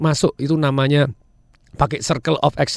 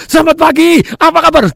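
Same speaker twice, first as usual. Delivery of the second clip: much higher, louder showman greeting; Tung Desa Maringin impression; 14 semitones higher, 8 dB louder than usual. Student imitating Tung Desa Maringin on stage, higher and louder. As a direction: boom out a greeting like a star trainer. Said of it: "seolah-olah geraknya seperti Tung Desa Maringin".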